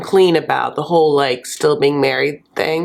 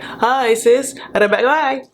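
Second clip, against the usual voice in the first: breezy Zayed; 6 semitones higher than usual. Retold or imitated. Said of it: "he's like".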